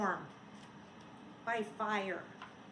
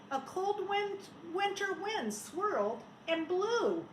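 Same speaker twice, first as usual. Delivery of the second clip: usual speaking voice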